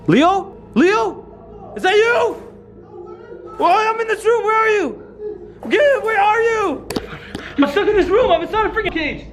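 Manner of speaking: high, drawn-out shouting; urgent calling out. Acted. Calling to Leo in another room, urgent high shout. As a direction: holler to someone through the walls.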